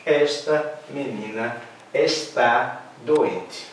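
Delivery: slow, careful "Esta menina está doente" with full "está"; slow correct model. Shown as a demonstration. Teacher to class, slow correct sentence. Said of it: "Então".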